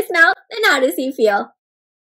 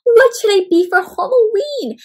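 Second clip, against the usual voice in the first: high-pitched musing question; wondering frog; 9 semitones higher, 4 dB louder than usual. A narrator's reading. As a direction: ponder aloud as young Froggy. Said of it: "Froggy wondered".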